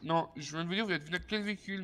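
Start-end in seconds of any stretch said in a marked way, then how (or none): none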